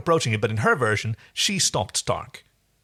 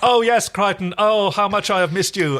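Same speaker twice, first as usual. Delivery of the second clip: higher, louder hammed-up gushing; mocking a bad liar gushing to Crichton, higher and louder; overacted bad liar; 7 semitones higher, 4 dB louder than usual. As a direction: ham up a fake longing. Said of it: "She is such a bad liar".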